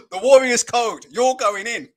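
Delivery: loud proud declaration; stern father. Retold or imitated. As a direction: proclaim the code like a creed. Father insists on code.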